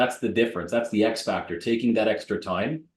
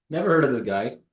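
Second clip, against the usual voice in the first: usual speaking voice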